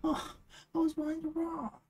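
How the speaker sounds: squeaky indignant complaint; high-pitched whine. Pretend.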